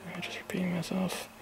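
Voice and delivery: soft unvoiced whisper to himself; breathy self-questioning whisper. Real, not acted.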